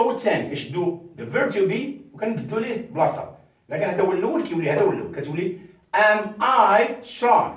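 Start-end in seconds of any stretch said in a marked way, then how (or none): 6.0-7.5 Am I strong, slow with loud I; slow question drill; slow question model. Shown as a demonstration. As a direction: teach the question slowly. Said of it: "with the question mark".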